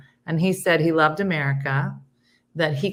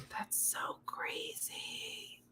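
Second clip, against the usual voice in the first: whisper; 21 dB quieter, much slower than usual. Real, not acted. Muttered reaction to news.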